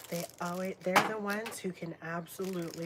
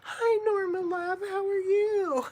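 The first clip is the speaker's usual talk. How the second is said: pet voice; 11 dB louder than usual. Real, not acted. Vlogger greeting Norma, squeaky high pet voice. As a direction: coo at the pet with a laugh.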